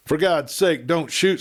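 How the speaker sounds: a narrator's mock scream, begging not to be shot; terrified scream. A narrator's reading. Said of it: "screamed the sheriff".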